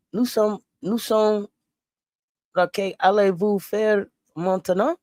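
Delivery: slow put-on French accent; mock French. Host saying a line in French, slow put-on French accent. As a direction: sound French, slowly and deliberately. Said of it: "how to say it in French".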